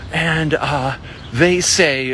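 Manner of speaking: usual speaking voice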